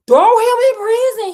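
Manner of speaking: loud, high shouted command; king shouting an order; furious cry. A narrator's reading. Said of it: "he cried".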